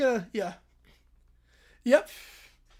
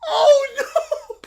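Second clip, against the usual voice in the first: high laughing oh no; giggling dread; 8 semitones higher, 5 dB louder than usual. Real, not acted. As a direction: laugh it out in horror. Streamer dreading where story goes.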